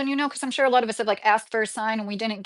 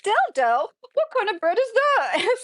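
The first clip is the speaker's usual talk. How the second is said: imitating her own confused double take, pitched much higher; baffled confusion; 11 semitones higher than usual. Streamer retelling how she misread a viewer's question, baffled. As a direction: replay the moment she misread the bread as a total puzzle. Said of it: "I was like".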